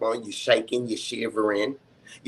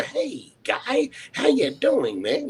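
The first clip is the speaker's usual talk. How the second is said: high, slow sugary greeting; chipper; 12 semitones higher, slower than usual. Retold or imitated. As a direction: stretch it out high and overly sweet. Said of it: "you wanna be chipper".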